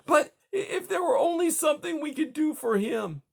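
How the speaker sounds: high, breaking tearful plea; tearful pleading. Acted. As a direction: plead through tears, voice cracking. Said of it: "through a blur of tears".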